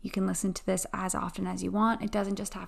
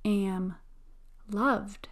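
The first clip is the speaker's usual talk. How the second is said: slow, calm affirmation voice; guided affirmations. Acted. Guided affirmation and breathing meditation.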